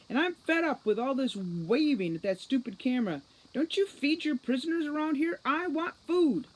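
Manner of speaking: loud, high angry complaint; shouting. Acted. Holly yelling at Juliet about food, loud and high. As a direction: rant at the jailer. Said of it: "shouted Holly".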